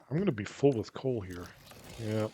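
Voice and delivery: ordinary talk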